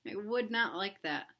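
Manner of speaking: plain reading voice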